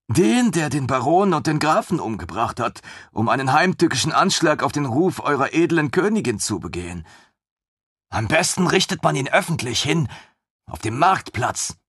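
put-on high, bright-eyed young officer, gushing; a thrilled lieutenant who can't believe his luck; eager lieutenant voice. Lieutenant gushing over the queen's griffin, high and eager.

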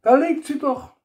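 high, bright-voiced wife pointing it out; beaming Rosi voice. A narrator's reading. Wife cheerfully points out the drill, high voice. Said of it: "signalisierte Rosi strahlend".

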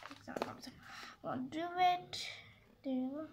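whispered under her breath to herself; muttered whisper. Real, not acted. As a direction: mumble it as a private aside after slipping up. Muttering to herself after a mistake.

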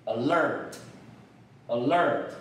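"alert" as a-LERT, second syllable drawn out; slow model reading of "alert", weight on LERT; stress on second syllable. Shown as a demonstration. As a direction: lean on the end of "alert". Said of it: "重音在".

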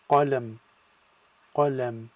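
"qalam" with "lam" closed on the m; blended word. Shown as a demonstration. Teacher blending letters into one word. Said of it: "join together to create one sound".

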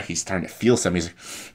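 normal talking voice

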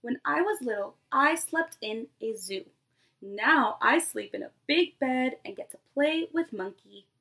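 lively picture-book read-aloud to a class; storybook voice for a nursery class; reading to children. Acted. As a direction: read it like story time for little kids. Teacher reads picture book aloud.

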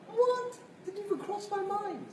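lightly played surprise; surprised uncle. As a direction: act amazed at the idea. Uncle's baffled reply continued.

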